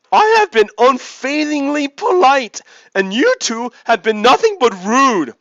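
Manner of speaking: high, loud, fake-polite sea lion. Sea lion answers the annoyed man, high and loud. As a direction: squeak it out with mock courtesy. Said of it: "sea lion continues".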